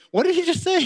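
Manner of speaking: high-pitched, disbelieving question; baffled disciples. Acted. Disciples reacting to Jesus' request, baffled. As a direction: pitch it up as if you can't believe your ears.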